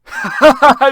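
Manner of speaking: loud high cackle; loud high laugh. Acted. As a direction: burst into a cackle. Mock-wise laugh.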